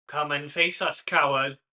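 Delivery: loud, taunting call across the field; shouting challenge. Acted. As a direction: call him out as a coward. GM voices hunter calling out.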